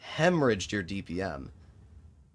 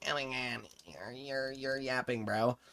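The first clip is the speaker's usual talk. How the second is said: muffled, softer mock-hater voice; 8 dB quieter than usual. Streamer imitating a hater in chat, mock mumbling. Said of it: "the hated guy, he's like".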